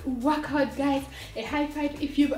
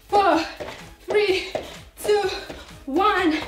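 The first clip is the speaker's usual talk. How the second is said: workout coach; 4 semitones higher, slower than usual. Acted. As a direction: pump up the class like a coach. Loud workout countdown.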